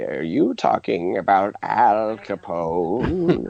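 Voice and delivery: put-on slow old witch voice. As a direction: drag it out like an old crone. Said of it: "she talked like the Wicked Witch".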